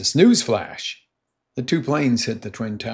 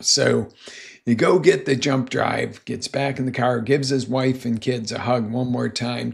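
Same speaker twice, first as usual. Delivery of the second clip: ordinary talk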